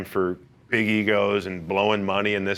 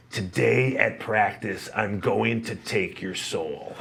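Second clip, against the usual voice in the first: menacing teammate threat; 4 dB quieter, much slower than usual. Retold or imitated.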